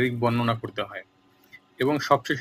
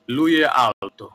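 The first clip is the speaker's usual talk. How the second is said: slow example sentence; 5 dB louder, much slower than usual. Shown as a demonstration. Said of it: "যেমন ধরুন".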